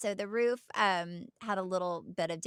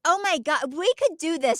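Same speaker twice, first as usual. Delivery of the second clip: excited; 9 semitones higher, 7 dB louder than usual. Real, not acted. Excited idea for their show.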